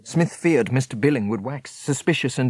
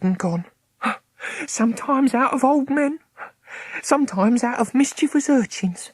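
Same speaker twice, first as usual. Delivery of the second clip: put-on higher, earnest old woman; old Meg explaining; 9 semitones higher than usual. A narrator's reading. Meg tells Smith her dead mother speaks, high voice. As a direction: tell it with wonder.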